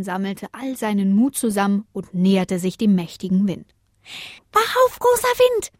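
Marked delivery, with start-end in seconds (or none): 4.5-5.7 very high, piercing yell; tiny voice at full squeal; shrieking little star. A narrator's reading. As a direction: scream it into a sleeper's ear. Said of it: "schrie es in das riesige Ohr".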